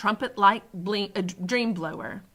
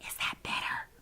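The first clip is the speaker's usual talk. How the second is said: soft, careful whispered question; 9 dB quieter than usual. A narrator's reading. Sophie checks with the pained giant, whispering. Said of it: "Sophie whispered".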